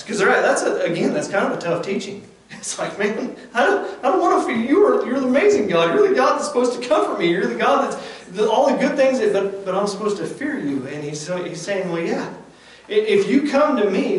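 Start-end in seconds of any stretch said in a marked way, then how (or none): 3.0-10.9 high, loud and pleading; pleading preaching. Acted. Preacher voices a believer protesting to God, pleading loud. 11.9-14.2 high and loud, promising; fervent preaching. Acted. Preacher gives God's answer to the believer, fervent preaching.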